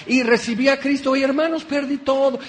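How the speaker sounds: high, self-pitying wail of loss; mock lament. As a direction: ham up the poor convert who lost everything.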